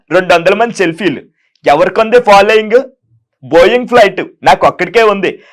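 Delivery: high, loud self-praising preacher voice; a showy, loud public-figure monologue; KA Paul impression. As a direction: boast grandly about your global following. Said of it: "KA Paul గారిది చేస్తారా?".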